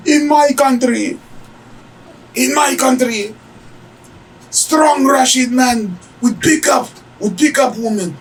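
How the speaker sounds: very high mock Russian woman; hammed-up high-pitched Russian complaint; indignant Russian woman. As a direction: imitate a Russian woman demanding respect, shrieky and high. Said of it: "she was kind of a hot Russian chick".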